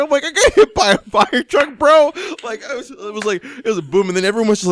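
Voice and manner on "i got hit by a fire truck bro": high, loud incredulous cry; hammed-up shock at the news; mock disbelief. Driver reacts to his passenger after the crash, mock disbelieving yell.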